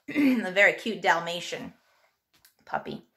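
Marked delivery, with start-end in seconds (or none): none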